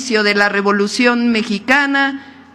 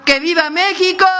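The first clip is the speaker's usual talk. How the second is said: raised rally cry, higher pitch; rally cheer; 7 semitones higher than usual. Real, not acted. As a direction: lift the crowd with the cheer. Rally cheer at speech end.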